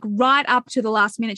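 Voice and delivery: ordinary talk, nothing marked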